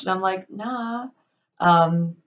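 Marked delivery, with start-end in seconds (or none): 0.5-1.1 high, softer brush-off; mock casual denial. Speaker brushing off coworker's question, high and falsely casual. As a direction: lift it high and toss it away.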